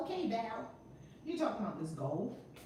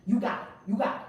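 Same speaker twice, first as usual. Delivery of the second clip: louder, pumped-up chant to the chat; hyped call; 7 dB louder, much faster than usual. Shown as a demonstration. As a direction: fire up the family. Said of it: "Now I wanna see you do it".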